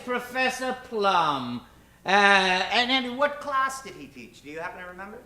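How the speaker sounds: ordinary delivery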